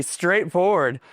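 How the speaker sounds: ordinary talk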